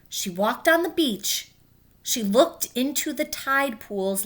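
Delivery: gentle read-aloud storytelling; clear, even picture-book narration; reading to children. A narrator's reading. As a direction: read it like story time for kids. Teacher reads picture book aloud.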